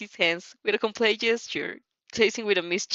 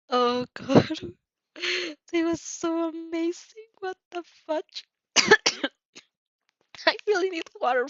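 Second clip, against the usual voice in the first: excited gushing; 8 semitones higher than usual. Real, not acted. Gushing over the scene.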